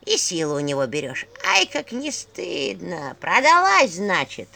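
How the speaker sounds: put-on creaky old woman's voice, loud and slow; скрипучий голос черепа. A narrator's reading. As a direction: spit it out like a cranky crone. Skull keeps scolding. (скрипучий старческий женский голос)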